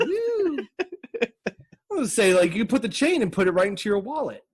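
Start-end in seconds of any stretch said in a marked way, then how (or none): 0.0-0.6 high squeaky woo; mock woo. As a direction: toss off a silly high cheer. Mock cheer.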